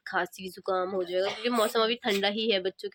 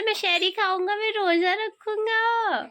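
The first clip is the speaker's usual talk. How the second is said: lightly voiced small boy's boast, much higher; imitating little son; 11 semitones higher than usual. Mother imitates her son's fasting boast in a higher kid voice. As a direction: mimic the toddler's proud promise, slowing at the end. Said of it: "आप कह रहे थे".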